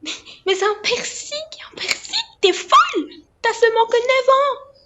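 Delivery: high, loud horrified shriek; mother shrieking at her daughter's piercing; shocked cry. Real, not acted. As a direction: scream out in sheer disbelief.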